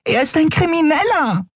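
high, tiny fairy voice, upset; a little winged friend scolding in alarm; agitated fairy. A narrator's reading. Nelen shrill alarm. (aufgeregte kleine Fee, hoch)

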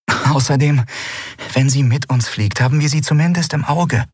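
high-pitched heroine making her case; Endriel, persuading. Acted. Endriel persuades the Skrier to take the girl, persuasive.

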